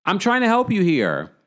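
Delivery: high, frustrated retelling. Retold or imitated. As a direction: plead your case in mock frustration. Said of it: "she gets frustrated".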